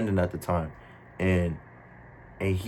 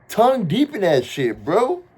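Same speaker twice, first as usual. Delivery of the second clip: amused disgust; 11 semitones higher, 6 dB louder than usual. Retold or imitated. Describing friend kissing.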